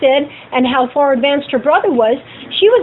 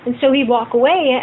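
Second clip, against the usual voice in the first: ordinary delivery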